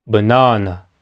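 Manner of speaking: "banana" low, with a long strong middle NA; stressed middle syllable. Shown as a demonstration. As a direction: say "banana" as one word, weak first and last vowels. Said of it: "A ver, una vez más".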